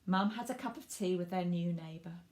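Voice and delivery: lively storybook narration for little ones; reading to children. A narrator's reading. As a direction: read it like bedtime story time. Reads picture book to children.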